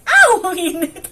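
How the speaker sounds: sudden ow of pain, laughing it off; burned yelp. Real, not acted. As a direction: yelp as your hand touches something hot. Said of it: "napaso ako".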